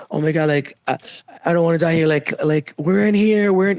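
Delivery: retold high-pitched excited plea; high, urgent imitation of an excited girl. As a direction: quote her panicky excitement, pitch jumping up. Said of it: "she got excited".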